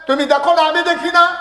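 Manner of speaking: high, loud preacher's cry; full-force preaching. Real, not acted. Preacher to listeners about the unseen dead, high loud cry. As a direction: cry it out over the crowd.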